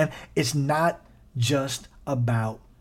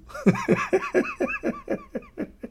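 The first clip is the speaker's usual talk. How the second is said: high squealing laugh. Real, not acted. Laughing after the punchline.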